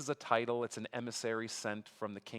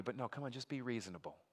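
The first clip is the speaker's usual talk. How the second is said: softer, wheedling persuasion; soft coaxing; 9 dB quieter, faster than usual. Acted. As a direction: lean in and sweet-talk them quietly. Envoy coaxing the besieged king.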